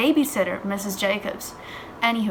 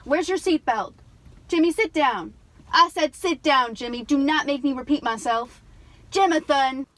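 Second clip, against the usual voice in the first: raised, higher-pitched mother's reprimand; stern scolding; 4 semitones higher, 4 dB louder than usual. Acted. Mother ordering her son to sit down, stern scolding. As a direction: order the child sharply, voice up.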